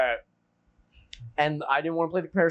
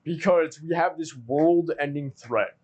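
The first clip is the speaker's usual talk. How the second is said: normal talking voice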